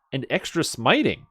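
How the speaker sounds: ordinary talk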